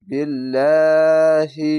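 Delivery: বিল্লাহি with a light, thin lam, slow and loud. Shown as a demonstration. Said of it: "বিল্লাহি পাতলা করে পড়".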